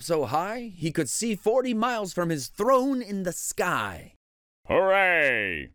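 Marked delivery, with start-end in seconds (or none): 4.7-5.7 mild triumphant shout; shouted cheer. A narrator's reading. Yertle up in the sky, shouting triumphantly. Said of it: "shouted Yertle".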